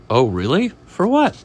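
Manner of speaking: rising to a high, loud question; acted storybook disbelief; sceptical chef. Chef hearing of the fish's college plan, acted scepticism.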